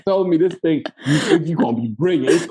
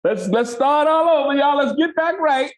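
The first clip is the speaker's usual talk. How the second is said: a touch higher, upbeat director calling for another take; director Michael; 7 semitones higher than usual. Retold or imitated. Director Michael asks actor for another take, light upbeat mimic. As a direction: lightly voice the director resetting the crew. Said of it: "And Michael go".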